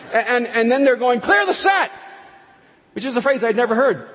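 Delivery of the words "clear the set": high, loud call across a film set; mock crew shout. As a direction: yell it out like a crew boss.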